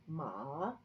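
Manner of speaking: "ma" in a slow V, down and up; third tone. Shown as a demonstration. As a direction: dip "ma" low, then lift it. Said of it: "it goes down and up".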